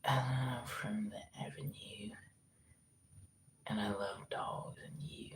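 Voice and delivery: very quiet, slow whispered rehearsal; szept pod nosem. Shown as a demonstration. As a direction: try the accent line out under your breath, slowly. Trying accent line under breath.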